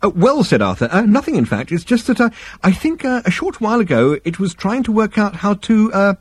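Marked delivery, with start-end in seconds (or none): 0.0-0.4 high, hesitant stalling; nervous. A narrator's reading. Arthur cornered by Zaphod, nervous high stalling. Arthur confesses under pressure. 0.9-6.1 high, halting guilty confession; nervous. A narrator's reading. Arthur confessing to angry Zaphod, nervous high stammering.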